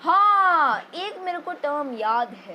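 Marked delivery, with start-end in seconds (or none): 0.0-0.8 high, loud, drawn-out mock eureka; overacted realisation. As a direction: burst out as if it just came to you. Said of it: "ज़्यादा acting हो गई". (अचानक याद आने का नाटकीय अंदाज़)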